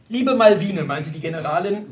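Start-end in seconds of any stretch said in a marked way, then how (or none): 0.1-0.8 loud, raised address to a companion; mildly scolding, with force; reproving. A narrator's reading. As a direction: start a firm rebuke. General's wife reproves her companion.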